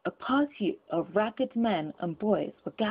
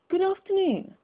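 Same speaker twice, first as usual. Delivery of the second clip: higher, sharp goodbye; curt dismissal; 11 semitones higher, 3 dB louder than usual. Acted. Scrooge sends the gentlemen off, sharp and higher. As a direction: dismiss them pointedly.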